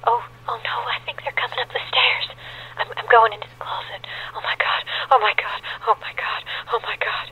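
terrified hushed whisper; whispering while hiding. Acted. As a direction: breathe it, scared of being heard. Hiding in closet, intruders near.

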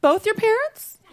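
very high rising question; incredulous woman. Retold or imitated. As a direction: imitate a woman doubting what she's heard. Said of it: "she was like".

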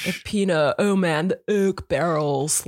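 dragged-out surfer dude drawl; mock California surfer admiring the wine. Imitating the guy on oak barrels, surfer dude. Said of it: "he talks like a total surfer".

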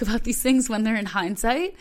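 normal talking voice

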